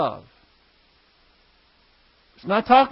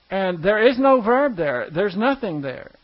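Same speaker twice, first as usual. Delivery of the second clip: ordinary talk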